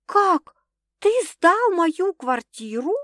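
outraged disbelief. A narrator's reading. Girlfriend outraged about her flat.

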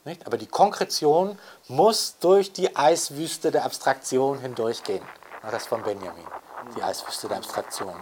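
usual speaking voice

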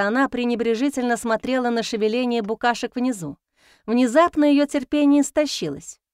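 usual speaking voice

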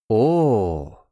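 drawn-out, understanding exclamation; knowing drawl. A narrator's reading. Young waiter grasps the vodka order, drawling knowingly. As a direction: stretch it out as it dawns. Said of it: "понимающе протянул".

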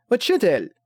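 high eager call. Acted. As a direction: call out to the teacher brightly. Girl calls out to teacher.

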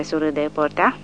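plain reading voice

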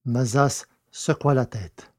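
ordinary delivery

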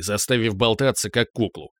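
ordinary delivery, nothing marked

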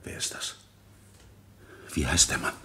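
urgent hushed whisper; tense whispered question over the railing. Acted. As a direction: breathe it so nobody downstairs hears. Watching visitor over the banister.

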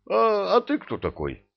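high, startled thief; startled high question. Acted. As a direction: jump in surprise. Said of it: "опомнился вор".

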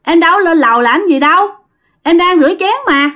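wife answering her husband from the kitchen, calling up; calling up the stairs. Retold or imitated. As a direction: call up to the floor above. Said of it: "nói với lên".

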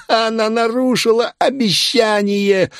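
very high, slow, crazed cry; maniacal exultation. A narrator's reading. Man exulting over a broken promise, maniacal. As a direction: crow it in a high manic voice.

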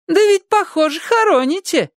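put-on high insistent old lady voice; nosy neighbour. A narrator's reading. Neighbour presses the children about the burial, high needling voice. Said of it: "neighbour insists to children".

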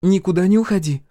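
high, loud command; ordering. A narrator's reading. Ранит orders brother to stay, commanding. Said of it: "велела Ранит".

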